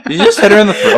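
words broken by laughter; laughing. Real, not acted. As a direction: laugh through the line. Laughing fit after a hit.

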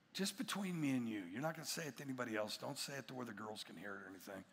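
low, very soft confidential murmur; acted hush, quick and low; hushed confiding. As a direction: drop your voice as if sharing a secret. Father confides secretly to young son.

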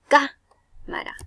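"Cámara" with CÁ high and drawn out; slow "Cámara", first syllable punched; stress on first syllable. Shown as a demonstration. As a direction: lean on the CÁ of "Cámara". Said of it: "también lleva en la K".

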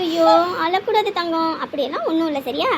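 low, soothing reassurance; tender consoling. Acted. Aunt telling a crying child not to cry, tender. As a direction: comfort a crying child gently.

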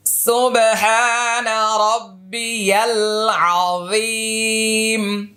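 full tasbih chanted slowly, long vowels; slow recitation. Shown as a demonstration. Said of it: "এভাবে তিনবার বলতে পারেন". (ধীরে সুর করে তাসবিহ)

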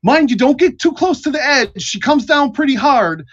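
high-pitched shouted warning; high, loud sentry call; yelling guard. Acted. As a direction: yell a warning down from the tower. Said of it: "and then the second voice yells out".

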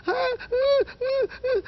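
very high imitated gasping cries; terrified stammering squeals. Shaken daughter trying to answer her father, high panicked squeals. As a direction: squeal as if unable to form words. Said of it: "And she said".

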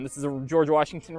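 ordinary talk

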